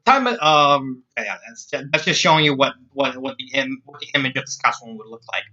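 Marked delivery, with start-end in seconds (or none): none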